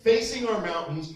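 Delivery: usual speaking voice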